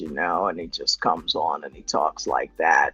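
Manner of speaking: very low, deep-voiced dude impression; Dom. Streamer imitating his deep-voiced friend for the chat, deep impersonation. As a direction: drop way down into a cool deep voice. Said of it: "I can't get as deep as Dom".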